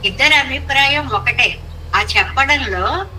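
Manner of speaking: ordinary talk, nothing marked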